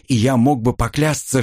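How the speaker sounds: ordinary delivery, nothing marked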